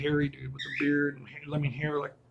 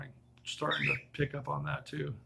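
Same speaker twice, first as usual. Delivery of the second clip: usual speaking voice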